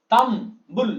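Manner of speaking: "তাম", short pause, "বুল"; two closed syllables. Shown as a demonstration. Teacher showing two closed syllables, "তাম বুল" said separately. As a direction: split the word into "তাম" and "বুল". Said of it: "দুবার উচ্চারণ করলাম".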